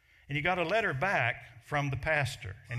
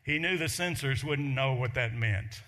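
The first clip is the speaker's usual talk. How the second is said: ordinary delivery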